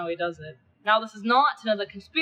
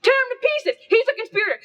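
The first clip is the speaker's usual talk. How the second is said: much higher and louder, yelling; frenzied shout; 13 semitones higher, 5 dB louder than usual. Acted. Mob yelling to tear Cinna apart, frenzied shout. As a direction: scream for blood like a lynch mob.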